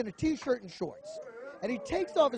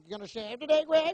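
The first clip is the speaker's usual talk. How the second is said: mocking taunt; 5 semitones higher than usual. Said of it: "he's mocking me".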